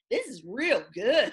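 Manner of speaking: slow, loud Missouri twang, drawn out; Missouri drawl. Retold or imitated. Guest retelling how her Missouri accent came out over the wine, slow and loud. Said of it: "my hometown came out".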